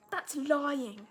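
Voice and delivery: English storybook character protesting; British accent. Acted. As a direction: exclaim it in a put-on British accent. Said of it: "using the British accent".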